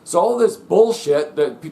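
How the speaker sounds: ordinary talk, nothing marked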